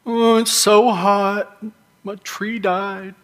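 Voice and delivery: slow, high mock whine; lightly hammed-up whiny complaint; whining. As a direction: whine it like a sulky kid. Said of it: "He starts whining".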